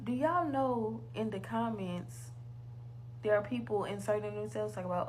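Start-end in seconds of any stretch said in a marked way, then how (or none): none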